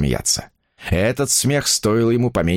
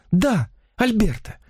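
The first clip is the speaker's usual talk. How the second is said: slow, much higher feminine reply; infatuated confirmation; 12 semitones higher, slower than usual. A narrator's reading. Woman confirms her lover's name to the writer, high infatuated voice. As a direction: confirm the name dreamily, pitched well up.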